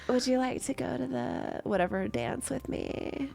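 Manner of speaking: soft sleepy bedroom voice; drowsy, hushed invitation. Retold or imitated. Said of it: "giving him bedroom voice".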